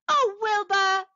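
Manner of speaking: high, delighted exclamation; joyful cry. A narrator's reading. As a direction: cry out in grateful delight to a pet. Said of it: "cried Winnie".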